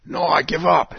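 Alice giving up; Alice's voice. Acted. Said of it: "says Alice".

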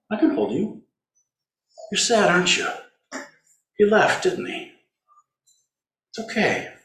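put-on tender, high baby-talk voice calling sorrow home; cooing to sorrow. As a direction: soothe it like a child called home.

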